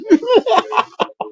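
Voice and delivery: squealing laugh pitched far up; helpless high-pitched giggle. Real, not acted. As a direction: let a high giggle burst out. Laughing at the botched entrance.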